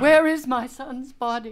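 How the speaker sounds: grieving mother crying out for her son's body; anguished cry. Acted. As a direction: cry out for the lost son. Mother demands her dead son.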